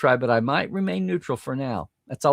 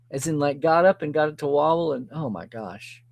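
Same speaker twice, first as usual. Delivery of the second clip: normal talking voice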